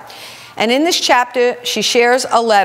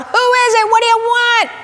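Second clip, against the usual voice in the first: much higher, sharp woken man calling through the door; gruff shout; 14 semitones higher, 3 dB louder than usual. Retold or imitated. Man behind the door shouting at the late stranger. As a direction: bark it like a man just woken up. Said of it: "a man's voice shuts out".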